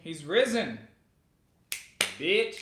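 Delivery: loud, high joking shout; mock Easter proclamation. Friend jokes about coming out at Easter, loud shout. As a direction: belt it out like a gleeful announcement.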